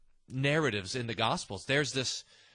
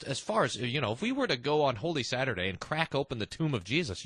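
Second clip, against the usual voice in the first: ordinary talk, nothing marked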